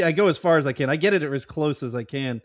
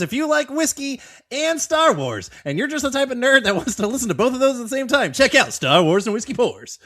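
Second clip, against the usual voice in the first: fast, upbeat promo read for "Star Wars and Whiskey Pours"; quick podcast plug; 8 semitones higher than usual. Acted. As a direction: rattle it off like a radio ad, pitched up. Plugs a friend's podcast.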